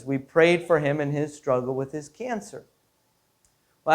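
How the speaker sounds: usual speaking voice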